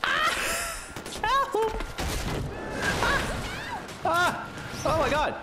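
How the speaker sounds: high panicked shrieks; shrieking jackpot winner. Real, not acted. Chased by boss, screaming.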